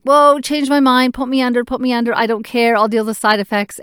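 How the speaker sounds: frantic plea to be put under; loud panicked begging to be sedated. Retold or imitated. Panic attack before surgery.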